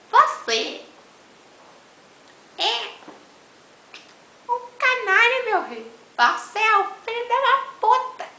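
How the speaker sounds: high, loud playful cussing; mock insult shout. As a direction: yell the insult jokingly. Xingando o amigo de brincadeira. (xingamento gritado de brincadeira)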